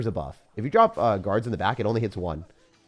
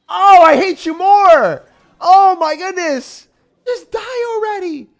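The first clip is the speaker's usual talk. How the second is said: much higher, louder, drawn-out cries; anguished yelling; 12 dB louder, much slower than usual. Real, not acted. Gamer yelling at the opponent crushing him, anguished shout. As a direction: scream in exasperation. Getting beaten in game.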